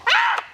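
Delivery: very high, loud yelp; shrill pained yelp. Shown as a demonstration. Trainer yelping when the dog bites too hard, shrill and loud. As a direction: yelp sharply like a bitten pup. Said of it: "I turn my way".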